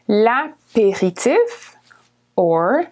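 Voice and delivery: l'apéritif drawn out slowly; slow model. Shown as a demonstration. Teacher slowing l'apéritif, careful model. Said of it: "or l'apéro for short".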